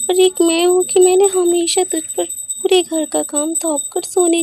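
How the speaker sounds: loud, emotional apology; remorseful pleading. Acted. Elder daughter-in-law begging both women's forgiveness, remorseful.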